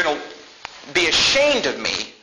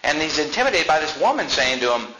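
ordinary talk

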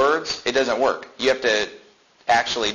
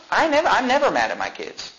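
ordinary talk, nothing marked

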